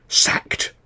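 sharp unvoiced whisper; hissed. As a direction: hiss it under your breath. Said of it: "Bert hissed".

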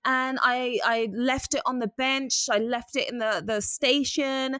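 high, hammed-up fake American accent; a poor teen imitation of American speech; teen faking an American accent to the conductor; bad American accent. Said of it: "we put on these fake American accents".

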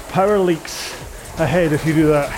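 normal talking voice

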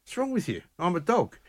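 indignant dog voice. Pretend. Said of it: "he'd just look at me like".

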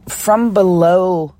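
usual speaking voice

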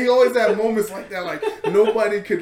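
high, loud laughter through words; breaking up at the joke; co-host repeats the line, he cracks up; laughing again. Real, not acted. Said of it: "He's an interior decorator".

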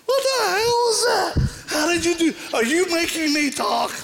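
high crying shout of a startled possessed man; shocked demon voice. Retold or imitated. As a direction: shriek it like something caught off guard. Possessed driver shocked, cussing.

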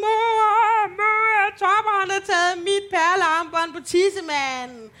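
put-on high, loud child's cry for mom; child shouting. Little girl shouting to her mother about her brother, high and loud. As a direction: yell for mom like a tattling kid. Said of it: "råber Kaja sådan".

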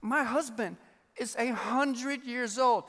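put-on slow, incredulous old Sarah; a hint of a laughing Sarah in disbelief; Sarah disbelieving. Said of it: "She's like".

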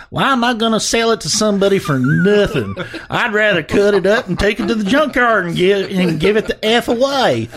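high, drawn-up yokel caricature, hammed up; imagined seller's voice. Host reading out the angry Camaro seller's excuse, mocking character voice. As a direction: put on a high-pitched mock voice for the car seller. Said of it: "read this in the voice that I think this guy has".

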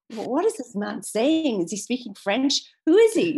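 mock-baffled questions, rising in pitch; bewildered. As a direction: play utter bewilderment, voice leaping up on the last question. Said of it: "she's totally bewildered. She's like".

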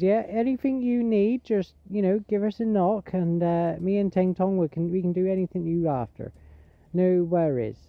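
put-on obliging West Country farmer host accent; farmer's accent. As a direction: offer help in a Gloucestershire farmer's accent. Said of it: "traditional farmer's accent".

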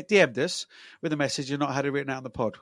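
ordinary talk

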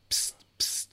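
whisper. Retold or imitated. Shady bloke calling them over.

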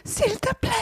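high pleading; beg, high and soft. Acted. Acted plea in scene.

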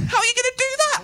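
very high, delighted squeak; happy drunk glee. Retold or imitated. Happy drunk asking Alistair about his threat, high squeal. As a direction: squeal it in mock wonder.

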